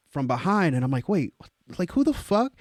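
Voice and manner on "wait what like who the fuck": rising, high-pitched retold reaction; startled confusion. Guest bumped from behind at party, startled. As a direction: sputter in rising disbelief.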